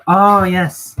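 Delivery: high, loud oh yes of recognition; high bright burst of realising; sudden realisation. Real, not acted. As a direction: it just clicked for you. Realises chat is right.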